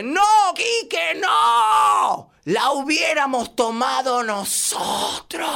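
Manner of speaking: slow, high, loud wailing cry; crying plea. Acted. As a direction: sob and shout as the juice is thrown away. Mamá ve tirar el jugo. (súplica llorando a gritos, lenta)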